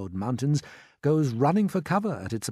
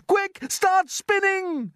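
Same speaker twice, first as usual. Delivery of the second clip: high, loud urgent order; boy shouting desperately, words stretched; yell; 6 dB louder, much slower than usual. A narrator's reading. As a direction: yell an emergency command. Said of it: "yelled James".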